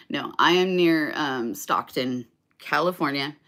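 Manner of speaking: ordinary talk, nothing marked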